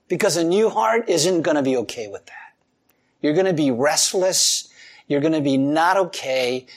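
usual speaking voice

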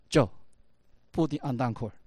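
ordinary talk

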